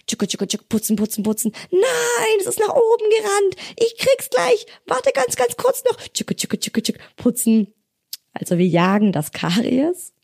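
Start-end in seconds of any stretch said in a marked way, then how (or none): 0.1-1.5 brushing noises. Acted. Brushing sound effect. 1.7-6.0 squeaky high, fast chase; very high playful voice. Acted. Playful caries hunt game. 6.1-7.7 whispered brushing noises. Acted. Brushing sound effect.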